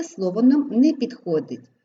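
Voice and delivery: usual speaking voice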